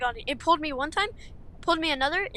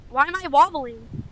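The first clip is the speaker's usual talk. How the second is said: alarmed shout; 3 semitones higher, 7 dB louder than usual. Real, not acted. Car shaking in wind.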